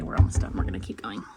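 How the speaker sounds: hushed whisper so the little one stays calm. Real, not acted. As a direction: drop to a breathy whisper, keeping the tour going. Quietly reassuring with kid nearby.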